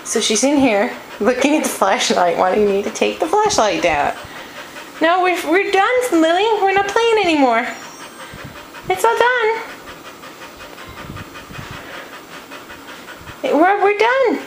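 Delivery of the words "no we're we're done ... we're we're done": firm dog commands. Real, not acted. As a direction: tell the dog playtime's over. Telling dog play is over.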